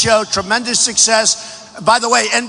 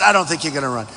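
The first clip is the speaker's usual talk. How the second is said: lower, softer mock echo of the doubters; everybody's doubt; 8 semitones lower, 4 dB quieter, much faster than usual. Rally speaker echoing the doubters, lower softer mock. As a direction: drop it low and soft, mocking everyone. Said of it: "Everybody said".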